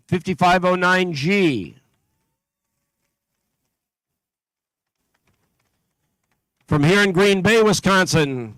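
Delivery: steady, carrying PA announcer voice; announcer read. Acted. Announcer calling out teams to the competition crowd, steady and carrying. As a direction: call out each town and team number to the hall.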